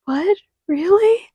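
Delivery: high rising disbelief, voice jumping up; squeaky shocked what, really; surprised. Retold or imitated. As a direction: react as if you can't believe it. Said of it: "I'm like".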